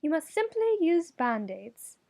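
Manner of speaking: high, serene certainty; beatific advice. Acted. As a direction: say it as if it's brilliant. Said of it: "the beatific smile".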